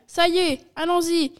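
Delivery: high, loud burst of urgency; excited cry. A narrator's reading. Maître Renard leaping up with an idea, crying out. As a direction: exclaim with sudden inspiration. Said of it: "il fit un bond et s'écria".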